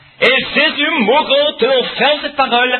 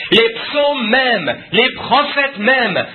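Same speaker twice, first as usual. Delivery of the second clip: slow emphatic preaching; 4 semitones higher, much slower than usual. Acted. Preacher stresses scripture word by word.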